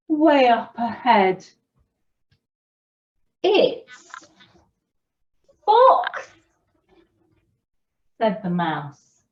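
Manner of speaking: slow, animated storyteller; story reading to children. A narrator's reading. Storyteller reading the Gruffalo to children, animated and unhurried. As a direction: read it slowly with big pitch swoops and pauses.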